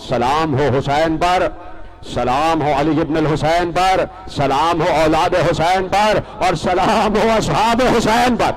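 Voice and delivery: rising salutation. Real, not acted. Preacher saluting Hussain and his companions, rising fervour. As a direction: build the salutations to a high, stretched peak.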